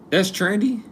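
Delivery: high-pitched disbelieving question; incredulous. Real, not acted. To chat about toilet slang, incredulous. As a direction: question it with your pitch shooting up.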